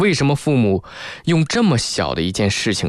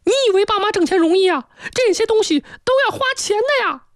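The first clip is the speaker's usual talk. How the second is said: hammed-up screechy angry mother; shrill scolding. Mother berating daughter about hard-earned money, shrill and indignant. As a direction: escalate into piercing, high-pitched nagging. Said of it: "妈妈是立即尖着嗓子就大声责骂他".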